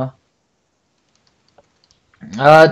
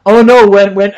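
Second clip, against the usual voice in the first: ordinary talk, nothing marked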